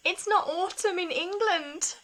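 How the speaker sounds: mock-tragic wail retelling her tears; imitating crying. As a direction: replay the meltdown as a hammed-up sob. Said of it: "and started, like, just really crying".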